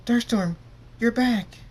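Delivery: loud, high joyful shout; excited cry. A narrator's reading. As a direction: call out, overjoyed at a return. Character runs up and hugs him.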